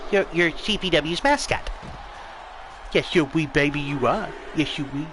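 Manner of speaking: hushed, doting coo. Real, not acted. Commentator fussing over the company mascot, soft baby talk. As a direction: dote on the little mascot softly.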